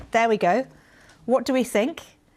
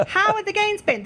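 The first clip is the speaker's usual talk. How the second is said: higher puppet voice for the toy; mascot voice; 11 semitones higher than usual. Pretend. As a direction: make the mascot say hello. Voicing toy mascots meeting.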